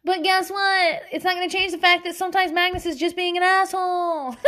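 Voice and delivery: high, emphatic mock announcement; comic insistence. Comic insistent declaration about child.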